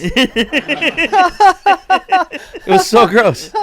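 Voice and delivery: laughing through disgust. Real, not acted. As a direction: laugh out the words. Retelling a gross story, laughing.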